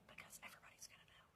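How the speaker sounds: quick secretive whisper; retold whisper. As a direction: breathe it out in a hush. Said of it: "Why are you whispering?".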